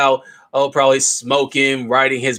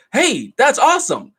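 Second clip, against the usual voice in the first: high, eager dumb-guy enthusiasm; hammed-up naive glee; jokey high voice, clueless and thrilled; mock excited; 12 semitones higher than usual. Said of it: "the guy's like".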